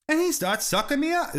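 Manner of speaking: put-on English accent. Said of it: "then I break the English accent".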